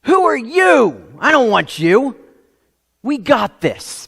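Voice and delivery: a scornful who-asked-you; dismissive rejection. Retold or imitated. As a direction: shove away the helper who shows up. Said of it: "They're like".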